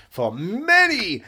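slow, drawn-out announcer voice; ad read. Pretend. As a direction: stretch it like a movie trailer. Mock advert pitch.

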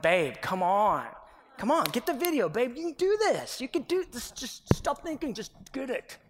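urgent coaxing. Retold or imitated. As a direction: coach your wife through the take, urging. Coaching wife through video take.

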